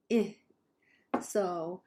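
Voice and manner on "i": "I" as a brief, level "ih"; short flatter vowel. Shown as a demonstration. Teacher contrasting vowel intonation, short flat "I" said "ih". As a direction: say "I" short as "ih", without an arc. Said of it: "I would say it's flatter".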